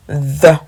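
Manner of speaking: a loud model "The"; th, tongue between teeth. Shown as a demonstration. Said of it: "avec la langue entre les dents".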